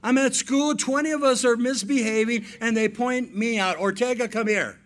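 put-on high, loud, aggrieved teenage complaint; a hammed-up daughter protesting; complaining daughter. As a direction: whine it like a wronged teen. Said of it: "she goes".

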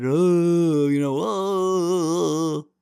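high, loud, very drawn-out fake fright; mock scared. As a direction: ham up a timid, scared person. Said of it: "scared to talk about it".

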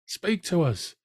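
high-pitched crowd plea; lightly acted entreaty, pitched well up; pleading. A narrator's reading. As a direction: cry out to him, imploring.

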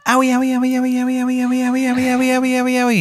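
high, whimpering owie chant; burning spirit whining in pain, very high; high whiny owie. Acted. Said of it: "you hear the spirit go".

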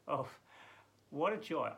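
ordinary talk

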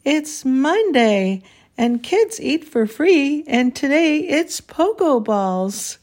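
a hammed-up boisterous waiter; boisterous exclaim. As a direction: proclaim it like a cheery host. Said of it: "boisterous waiter exclaimed".

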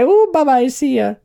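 high, playful bye-bye; sing-song goodbye. Pretend. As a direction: wave the accent off, teasing. Mocking the vanishing accent.

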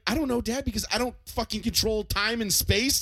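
high, loud exasperated retort; exasperated kid. Retold or imitated. Son snapping back at dad about the dryer. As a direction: fire back at dad, fed up. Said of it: "I'm like".

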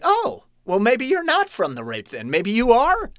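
high, eager "oh, well" of surprise; surprised realization. Retold or imitated. Birth mother's surprised retold reply.

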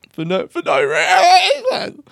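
half laugh, half sob at yourself; laugh-crying. Real, not acted. Host asks listeners, laughing helplessly and slowly.

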